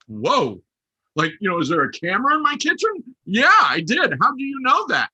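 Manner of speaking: loud, high startled reaction; retold astonishment, rising in pitch and volume; taken aback. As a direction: blurt it out, alarmed at being found out. Retold shocked reaction to agent's call.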